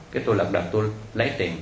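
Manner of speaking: usual speaking voice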